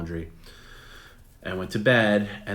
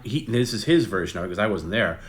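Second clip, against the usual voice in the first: normal talking voice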